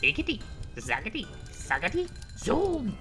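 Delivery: witch spell. Acted. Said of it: "she muttered a spell".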